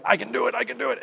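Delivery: light, high-pitched imitation of kids; imitating insistent kids. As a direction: mimic a child straining to manage alone. Voices his small kids insisting.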